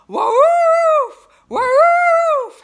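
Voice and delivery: long, high, very loud howled woofs; desperate dog howl, full volume. A narrator's reading. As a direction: stretch each woof into a howl. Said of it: "howled Rufus".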